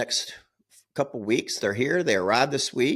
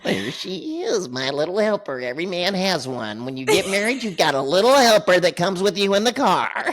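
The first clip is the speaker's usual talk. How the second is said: mock doting husband; 7 semitones higher, 3 dB louder than usual. Imitating husband again.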